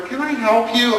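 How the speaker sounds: lightly voiced store manager, pitched high; a hint of an over-polite manager, high-pitched. Store manager approaching a screaming scene, lightly imitated. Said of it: "The store manager comes over".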